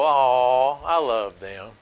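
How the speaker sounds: soft, low, fond sigh of love; tender aw. Retold or imitated. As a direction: melt a little as you say it. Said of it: "and I go".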